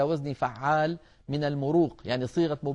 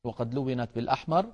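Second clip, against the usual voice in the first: normal talking voice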